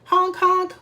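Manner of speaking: honking school bus imitation. A narrator's reading. Reading a farm book to children, imitated bus honk. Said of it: "went the school bus".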